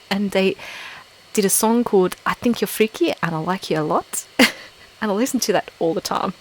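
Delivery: playful South African accent; chatty take on a South African accent. Shown as a demonstration. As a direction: put on your best South African accent. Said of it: "very best South African accent".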